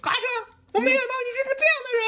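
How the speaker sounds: high, fast, hammed-up weepy accusation; mock crying. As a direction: squeak it out like a tearful girl. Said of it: "哭着跑到我面前说". (女混混哭着指责：尖声快速的假哭)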